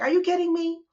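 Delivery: sudden high squeal of disbelief; mock-outraged yelp at a half-eaten apple; incredulous outburst. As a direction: shoot right up in pitch, can't believe it.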